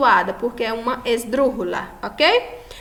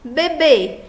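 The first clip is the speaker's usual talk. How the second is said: "Bebê" pitched up, with a strong second syllable BÊ; final-syllable stress; 4 semitones higher, 4 dB louder than usual. Shown as a demonstration. Teacher showing the tonic syllable, "Bebê" with raised final stress. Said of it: "A última sílaba mais forte".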